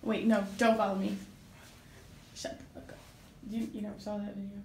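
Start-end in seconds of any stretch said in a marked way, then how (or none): 2.3-3.0 breathless whisper of disbelief. Real, not acted. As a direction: whisper it in shock. Stunned reaction to friend.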